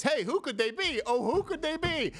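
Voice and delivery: high, teasing singsong; playful mock-innocent wonder; mock coy. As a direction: tease like a coy guessing game. Mock coy guessing game.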